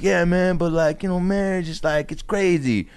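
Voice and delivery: high, whiny mock excuse; put-on high voice, dragging at the end; hedging boyfriend. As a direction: imitate a guy squirming out of commitment.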